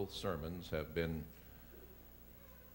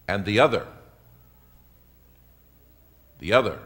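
loud, emphatic sermon delivery; forceful preaching; 4 semitones higher, 13 dB louder than usual. Real, not acted. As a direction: preach it out to the whole congregation, with weighty pauses.

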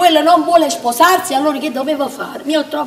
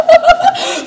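loud high laugh; 5 dB louder than usual. Acted. Bursts out laughing.

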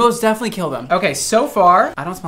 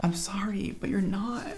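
hushed whisper to the mannequin; 2 semitones higher, 11 dB quieter than usual. Real, not acted. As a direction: whisper it like a secret. Aside to the mannequin head.

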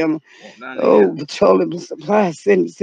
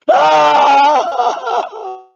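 frightened cry; 5 semitones higher, 4 dB louder than usual. Acted.

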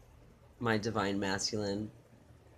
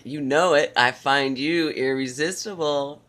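hammed-up declaration; 3 semitones higher, 7 dB louder than usual. Mock seduction, louder.

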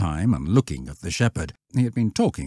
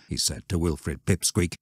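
ordinary delivery

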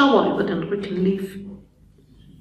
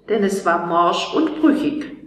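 usual speaking voice

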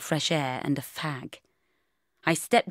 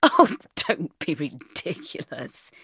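slow, lightly amused put-on male voice; amused dismissal; slower than usual. A narrator's reading. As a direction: draw it out with a touch of amusement. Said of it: "he said, laughing".

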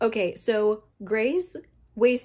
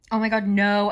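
usual speaking voice

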